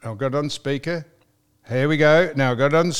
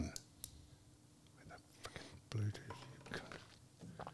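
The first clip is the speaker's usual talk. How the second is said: slow, breathy mutter under his breath; frustrated whisper; 5 semitones lower, 29 dB quieter, much slower than usual. Real, not acted. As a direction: hiss it out slowly to yourself. Muttering at failing equipment.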